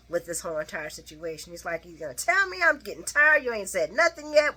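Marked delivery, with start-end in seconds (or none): 1.8-4.6 impatient Sonny. Acted. Sonny losing his patience.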